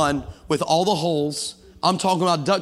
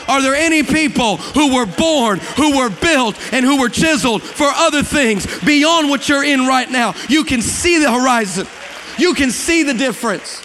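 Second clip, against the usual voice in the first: higher and louder, driving climax of the sermon; loud, high preacher's call to the church; preaching at full force; 5 semitones higher, 4 dB louder than usual. Acted. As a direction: lift the congregation, full power. Peak of sermon.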